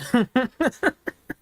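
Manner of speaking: high giggling laugh; real laughter at own joke; amused laugh. Real, not acted. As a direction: break into an amused high chuckle.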